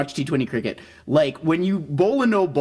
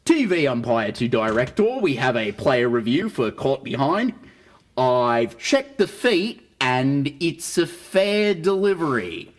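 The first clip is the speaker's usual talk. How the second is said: Australian umpire review call; Australian TV umpire reading the review; Australian umpire announcement. Retold or imitated. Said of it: "I'll do my impression of an Australian umpire doing a review".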